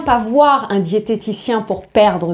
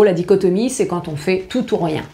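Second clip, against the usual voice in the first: normal talking voice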